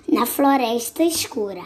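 plain reading voice

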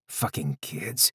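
muttered. Acted. Muttering in disgust.

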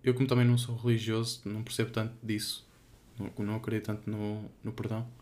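ordinary talk, nothing marked

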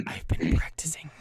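forceful, secretive whisper; loud stage whisper. Acted. Thief answering stunned party, intense stage whisper. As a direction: whisper it hard, with menace.

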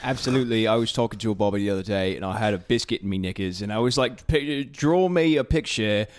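cheeky, exaggerated British voice; mock British accent. Shown as a demonstration. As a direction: tell it in a hammed-up British accent. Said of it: "our British accents are better than".

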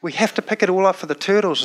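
a hint of an earnest little boy; four-year-old son. Son urging parents to save turtles, light child voice. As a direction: insist sweetly like a determined child. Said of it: "And he goes".

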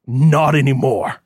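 lightly voiced cold old man; a hint of a harsh patriarch; stern old father.

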